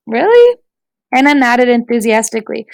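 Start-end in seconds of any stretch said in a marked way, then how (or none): 0.1-0.6 leaping high surprised question; delighted, rising disbelief. A narrator's reading. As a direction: pitch it way up in disbelief.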